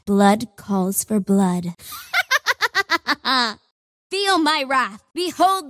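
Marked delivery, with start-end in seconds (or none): 1.9-3.5 menacing high laugh; villain laugh. Acted. Kana gloating over enemies, villainous laugh. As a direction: cackle in triumph at the weak.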